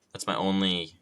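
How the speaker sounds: ordinary talk, nothing marked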